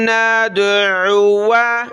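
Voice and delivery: नदउआ drawn out very slowly, no long waw; recitation. Shown as a demonstration. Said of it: "इस अलिफ को हमने बिल्कुल भी पढ़ना नहीं है".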